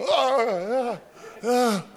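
high, loud yelps of pain; pained cries. Retold or imitated. Eddie being hauled up, high yelping. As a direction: yelp as the rope yanks.

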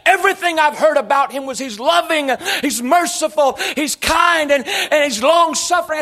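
high, driving, loud protest; upset, impassioned preaching. Real, not acted. Preacher telling church it's not fair to him, upset impassioned preaching. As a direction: build into an indignant plea at full force. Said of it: "I get upset with God".

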